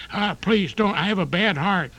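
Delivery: put-on a frail old hoofer fretting in a stuck lift; elderly tap dancer. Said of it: "An elderly tap dancer trapped in an elevator".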